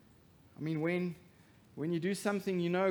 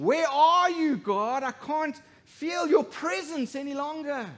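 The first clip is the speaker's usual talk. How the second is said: high, loud anguished cry to God; loud, high desperate plea; 11 semitones higher, 10 dB louder than usual. Acted. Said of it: "cried out in this great voice of desperation".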